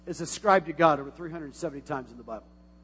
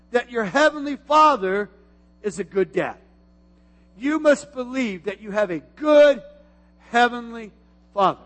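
raised, emphatic sermon voice; preaching; 3 semitones higher, 6 dB louder than usual. Acted. Pastor urging the church to believe, preaching. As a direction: preach it out to the congregation.

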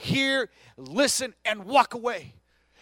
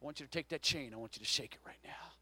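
soft, near-whispered urging; quiet altar-call urging; hushed; 10 semitones lower, 16 dB quieter, faster than usual. Real, not acted. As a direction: draw them in with a low hush. Preacher hushes congregation before altar call.

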